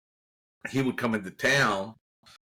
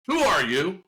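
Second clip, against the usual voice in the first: higher-pitched, demanding question; retold challenge; 6 semitones higher than usual. Ernie challenging a stranger on the field, mock demanding. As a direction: challenge him like a suspicious official.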